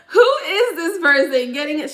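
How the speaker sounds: amused shock at the spirit's cheek; laughing disbelief. Retold or imitated.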